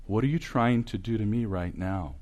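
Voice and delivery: soft, mild question; gentle. Retold or imitated. Speaker gently answering a student after the lecture, gentle. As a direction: put it to her softly, without any edge. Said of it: "And I said to her gently".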